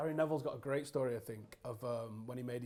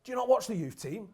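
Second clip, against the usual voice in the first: mock furious yell, higher and louder; retold angry yell; 9 semitones higher, 9 dB louder than usual. Fergie tearing into Pallister after Neville's debut, with a loud mock yell. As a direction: bawl it out like a raging manager. Manager rants in dressing room.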